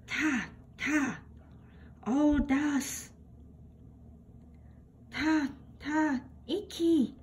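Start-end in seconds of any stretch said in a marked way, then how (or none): none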